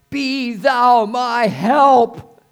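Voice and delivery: loud, high, long-drawn cry for help; crying out to God. Acted. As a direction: stretch every word into a booming plea. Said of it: "as we cry out to him".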